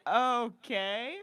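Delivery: drawn-out, rising okay; imitated sheepish driver. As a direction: imitate him reluctantly giving in. Driver's taken-aback reply, acted out.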